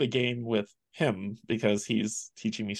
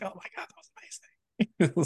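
excited; 8 semitones higher than usual. Retold or imitated. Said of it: "I'm like".

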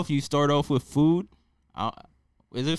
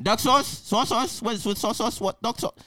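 a hammed-up Chinese restaurant accent, pitched higher; mock Chinese takeout accent; 6 semitones higher than usual. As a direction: play the takeout worker asking about sauces in a mock Chinese accent. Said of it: "I don't even know what accent that is".

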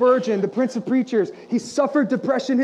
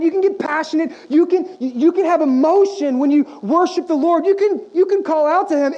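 higher and louder exhortation to worship; passionate preaching; 6 semitones higher, 4 dB louder than usual. Acted. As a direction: urge the congregation to get excited.

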